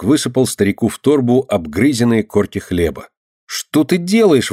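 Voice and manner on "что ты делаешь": high, slow indignant question. A narrator's reading. Girl to boy giving gnawed crusts, indignant. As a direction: draw it out in outrage. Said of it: "возмутилась Динка".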